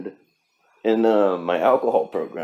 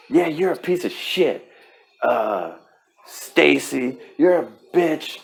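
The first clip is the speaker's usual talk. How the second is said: higher, louder, hammed-up berating; mock insulting a friend; 7 semitones higher, 4 dB louder than usual. Berating a friend named Stacy, mock shouting. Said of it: "would you just be like".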